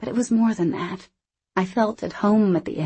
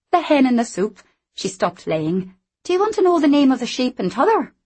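higher, brighter character voice; lively young Scottish woman; 7 semitones higher, 4 dB louder than usual. Acted. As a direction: play her cheeky and quick. Anna voiced.

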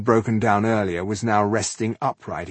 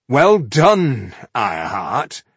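sarcastic; 4 semitones higher, 7 dB louder, slower than usual. A narrator's reading. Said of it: "he said sarcastically".

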